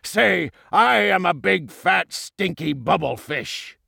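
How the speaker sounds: angry bully voice, slowing at the end; enraged, menacing villain king; screamed villain order. A narrator's reading. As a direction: force the words on him with hatred. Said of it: "he screamed".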